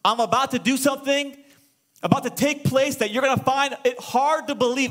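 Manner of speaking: lightly acted divine announcement; God responding. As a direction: announce it as God, building anticipation. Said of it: "And God responds with this".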